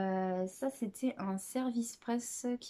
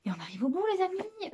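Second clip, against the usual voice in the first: playful announcement; 10 semitones higher, 5 dB louder, faster than usual. Real, not acted. Telling viewers the list is ending, playful high call. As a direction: sing out the ending, pitch leaping up on the last words.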